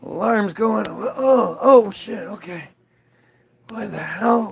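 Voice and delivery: normal talking voice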